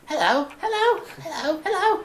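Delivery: high demon voice, trying out hellos; tentative, very high infernal-accented greeting; demon's character voice. Acted. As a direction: find the demon's high-pitched voice. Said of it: "Wait, I had a voice".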